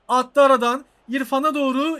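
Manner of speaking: loud, rising burst of excitement; excited shout. Real, not acted. Gamer calling the through ball to viewers, loud and excited. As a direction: yell it as the ball slips through.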